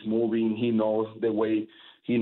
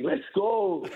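high-pitched victory yell, held back in volume; a little demo of a game-winning scream; half-hearted scream; 10 semitones higher than usual. Shown as a demonstration. Closer demonstrating his game-ending yell on request, half-hearted. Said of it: "give me a little version of the scream".

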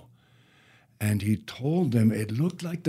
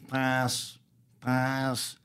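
duck quacking; 2 semitones higher, 7 dB quieter than usual. Acted. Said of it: "the duck and the cow".

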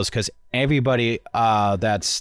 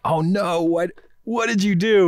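mock alarm; 8 semitones higher, slower than usual. Viewer's alarm at gun handover.

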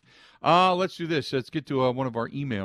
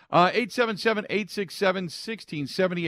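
loud announcement; 10 semitones higher, 8 dB louder than usual. Real, not acted. Announcing call-in number loudly.

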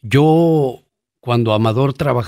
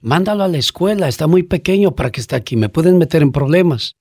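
manager Helen's voice; 6 semitones higher than usual. Retold or imitated. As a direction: scold the cook, half in English. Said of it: "decía". (voz de gerente mandona)

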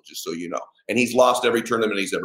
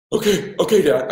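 put-on scared kid; 7 semitones higher than usual. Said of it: "looking at me all scared".

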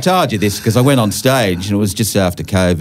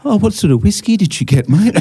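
higher, breezy fake-casual question; mock small talk; 12 semitones higher than usual. Comic bombing on stage asks the widower about his whiskey, in a higher fake-casual voice. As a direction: stall for time with awkward chit-chat. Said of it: "I was like".

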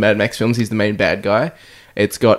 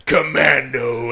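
movie title voice; much slower than usual. Retold or imitated. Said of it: "and then it's like".

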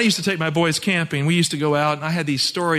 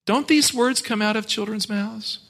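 ordinary talk

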